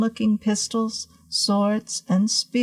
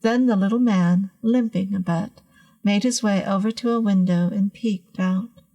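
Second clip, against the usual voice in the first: plain reading voice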